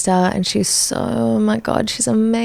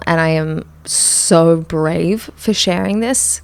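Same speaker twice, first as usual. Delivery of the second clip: usual speaking voice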